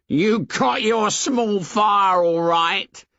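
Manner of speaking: high, slow mocking taunt of a schoolboy; sarcastic jeer. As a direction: drag it out like a sneering bully. Jack taunts Ralph about fire.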